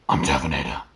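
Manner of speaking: low growling rasp; Batman voice. Pretend. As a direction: growl it like a caped hero.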